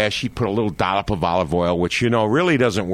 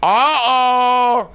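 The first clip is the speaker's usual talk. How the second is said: put-on louder, higher "Uh-oh" in a thick Italian accent with a long fall; Italian aunt's drawn-out warning; Aunt Mary's thick Italian accent, mock dismay; 13 semitones higher, 5 dB louder than usual. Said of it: "in her broken Eng- ... thick Italian accent".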